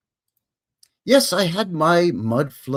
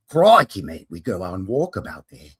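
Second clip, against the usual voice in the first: hammed-up Australian accent, punching the first word; badly faked Aussie accent; 5 semitones lower, 4 dB louder than usual. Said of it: "I can't do an Aussie accent".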